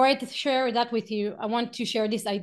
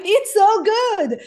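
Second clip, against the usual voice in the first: much higher and louder, slow and savoring; raving; 9 semitones higher, 9 dB louder, slower than usual. Acted. Selling bacon to a crowd, slow gushing rave. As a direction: gush over it like it's irresistible.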